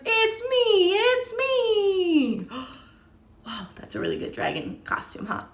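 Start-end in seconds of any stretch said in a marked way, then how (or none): 0.0-2.5 higher, excited little voice; horse character. Acted. Horse reveals itself under the dragon mask, high. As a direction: announce yourself as the horse. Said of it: "says the horse".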